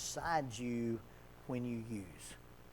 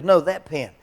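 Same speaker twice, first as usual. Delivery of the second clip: louder mock insistence; exasperated client; 4 semitones higher, 17 dB louder than usual. Client insisting on the pen, louder mock insistence. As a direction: push back, louder.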